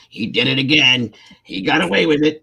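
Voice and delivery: mock-nag the husband who got played; scolding wife. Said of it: "And then it's World War Three".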